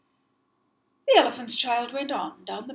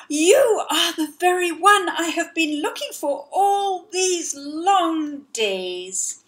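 higher, louder, panting with joy; breathless excitement; 7 semitones higher, 6 dB louder, slower than usual. Acted. Elephant's child excitedly greeting the crocodile. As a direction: gasp it out, thrilled. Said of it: "so excited he was breathless and panting".

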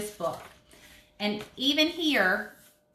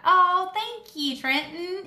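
pleasantly surprised thanks; 9 semitones higher, 4 dB louder than usual. Real, not acted. Child wishes happy birthday.

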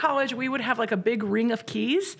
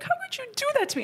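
much higher, fast indignant outburst; pissed off; 3 dB quieter, much faster than usual. Retold or imitated. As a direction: rattle off the accusation in a high rush of anger. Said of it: "I was really pissed".

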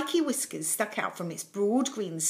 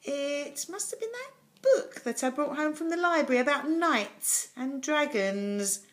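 higher voice, working it out aloud; thoughtful; 7 semitones higher than usual. A narrator's reading. Jonathan works out where the dragon came from, thoughtfully. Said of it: "Jonathan said thoughtfully".

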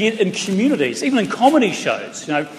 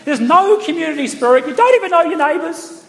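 heckling Christchurch woman; 9 semitones higher than usual. Retold or imitated. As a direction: put on a prissy lady lecturing the comic. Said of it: "she just stands up and goes".

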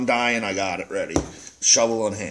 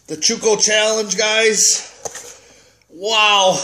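fired up; 6 semitones higher than usual. Real, not acted. Still burning, talking to viewers.

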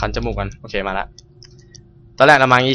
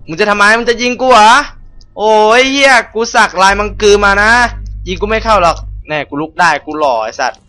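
higher, cocky boasting at the enemy; mock taunting; 9 semitones higher, 4 dB louder than usual. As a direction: swagger and dare them to shoot. Taunting the enemy after falling. (ท้าทายแบบกวนๆ)

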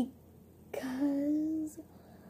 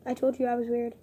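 normal talking voice

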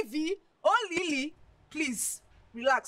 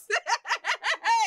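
laugh; 10 semitones higher, 4 dB louder than usual. Acted. Friend laughing at the idea.

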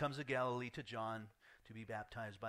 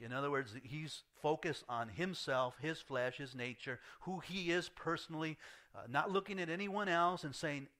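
ordinary talk

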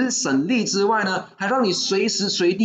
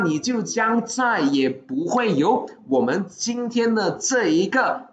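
ordinary talk

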